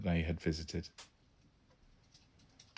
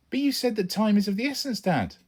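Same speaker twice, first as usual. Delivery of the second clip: put-on high, louder boy's protest; 5 dB louder than usual. A narrator's reading. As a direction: object to turning back. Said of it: "I protested".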